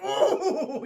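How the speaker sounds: high, loud, laughing "ooh"; teasing "ooh". Acted. Streamer reacting to the comeback, high amused hoot. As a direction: hoot at a sick burn.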